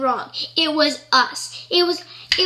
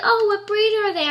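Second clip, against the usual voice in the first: higher, lightly put-on friendly question; curious dog owner; 6 semitones higher than usual. Dog owner asking about her two dogs, curious. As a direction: imitate polite small talk with raised pitch.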